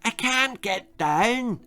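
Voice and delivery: put-on high, slow girl's voice, stuck and pleading; a stuck little girl calling for help, slowly. A narrator's reading. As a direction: plead like a girl wedged in a pile of clothes. Bessie's voice, stuck.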